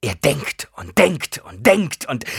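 slow, loud, surging on each DENKT; anguished, obsessive build; tormented repetition. A narrator's reading. Frantic, obsessive repetition, cut off.

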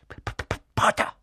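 stuck on the first sound, voiceless stutter; repeated popping P before the word; stammering. A narrator's reading. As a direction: struggle nervously to get the name out. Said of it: "stammelte Professor Quirrell".

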